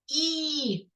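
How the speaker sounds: a long, held "і"; isolated vowel sound. Shown as a demonstration. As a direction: sustain "і" by itself. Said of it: "звук і".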